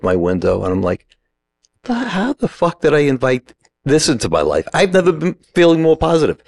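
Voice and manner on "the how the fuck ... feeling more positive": high, incredulous inner wail; exasperated disbelief. Retold or imitated. Driver under attack questions his life, exasperated disbelief. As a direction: ask it high-pitched, baffled at your own luck.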